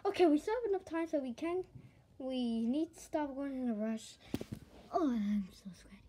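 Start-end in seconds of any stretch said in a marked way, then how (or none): 4.9-6.0 breathy whisper, lower; whispered aside. Acted. Friend mutters about sweating, whispered. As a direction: whisper it to yourself, worn out.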